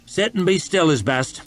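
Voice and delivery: put-on Northern accent, telling the captive to keep still; thick Northern accent. A narrator's reading. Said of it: "accent so thick that West could scarcely understand".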